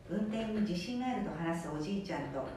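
ordinary delivery

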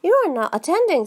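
sharp, fast and stern; severe. A narrator's reading. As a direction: scold her severely. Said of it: "said the Mouse to Alice severely".

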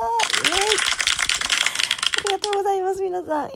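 high, loud happy whoop; excited cheer. Real, not acted. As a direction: cheer for the good news. Celebrating follower milestone.

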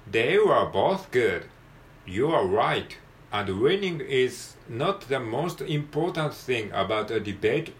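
slow, deliberate English textbook reading; model read-aloud. Shown as a demonstration. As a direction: read it through slowly for learners to follow, phrase by phrase. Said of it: "一回通して読んでみましょう".

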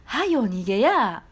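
corrected line in Nagoya dialect; Nagoya dialect version. Shown as a demonstration. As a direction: drawl はよ逃げや in Nagoya dialect. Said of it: "名古屋弁にするんだったら".

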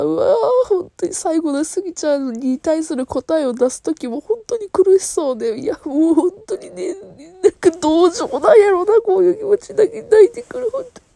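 high, tearful, voice breaking; fighting back tears mid-sentence; real crying. Real, not acted. Host tearing up over the lead's painful choice, voice breaking.

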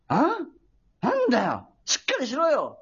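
mild mock-angry store manager; retold scolding. Store manager scolding his clerk, a mildly acted reprimand. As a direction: imitate an irritated boss's reprimand. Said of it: "なんか怒られちゃいましたね".

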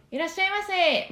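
「いらっしゃいませ」 high, loud, pressed and ringing; firm shop greeting. Shown as a demonstration. Voice teacher demonstrating a closed voice, high shop greeting. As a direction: greet a customer with a clear ringing voice. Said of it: "もう本当にこういう声ですよ".

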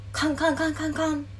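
high, quick kan-kan clanging; imitated clanging. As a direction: mimic feet ringing on metal stairs. Imitating footsteps clanging on stairs.

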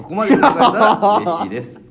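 reading a line and cracking up mid-way; laughing, high and loud. Real, not acted. Reading a listener's letter aloud, bursting into laughter.

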